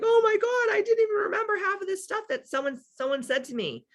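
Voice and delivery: hammed-up weepy client; mock crying. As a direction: imitate them sobbing. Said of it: "They're like".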